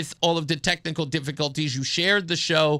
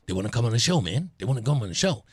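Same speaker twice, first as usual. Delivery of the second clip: friend on phone; 6 semitones lower, faster than usual. Retold or imitated.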